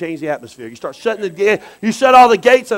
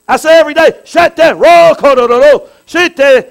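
loud praying; 5 semitones higher, 8 dB louder than usual. Shown as a demonstration. Preacher demonstrating tongues prayer.

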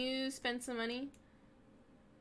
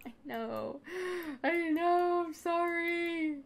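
high, slow cooing apology; mock soothing; 7 semitones higher, 4 dB louder, much slower than usual. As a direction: coo to a hungry pet. Soothing hungry Sim.

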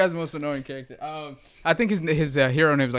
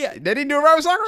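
incredulous; 9 semitones higher, much faster than usual. Real, not acted. Incredulous fast.

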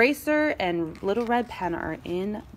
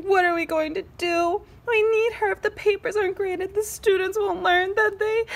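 high, hammed-up tearful wail; crying; 10 semitones higher than usual. A narrator's reading. Pencil frets over lost pen, mock crying. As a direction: whine as if in tears. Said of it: "He started crying".

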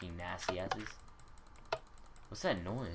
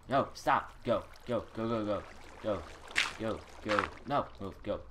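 panicked shouting; 3 semitones higher, 5 dB louder than usual. Real, not acted. Panicked fleeing.

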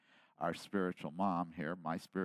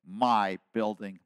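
ordinary delivery

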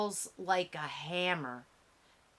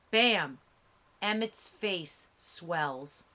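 ordinary delivery

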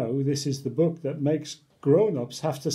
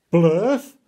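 Blurf blurted out loud; a loud confused blurt; loud puzzled blurt; 8 dB louder than usual. Acted. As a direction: spit out the nonsense word, baffled. Reading forced silly word.

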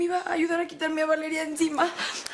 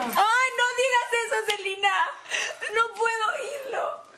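sobbing plea, higher; crying protest; 4 semitones higher than usual. Acted. Sobbing at Celina not to say stepmother. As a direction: cry out and beg her to stop. Crying, begging friend to stop.

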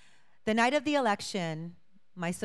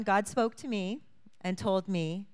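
ordinary talk, nothing marked

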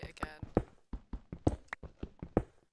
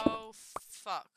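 startled. Real, not acted. Startled by mob in tunnel.

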